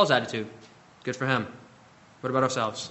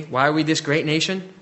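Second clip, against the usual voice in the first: normal talking voice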